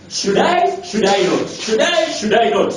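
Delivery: high rising "should I", dropping on the "not"; dithering cat. Acted. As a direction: act out a cat wavering at the roadside. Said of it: "it's on the pavement and it's going".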